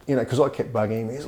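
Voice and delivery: ordinary talk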